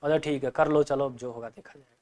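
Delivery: a reluctant okay trailing off; reluctant agreement. Shown as a demonstration. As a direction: agree while sounding unconvinced. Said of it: "मेरे बोलने की जो tone है वो कुछ और कह रही".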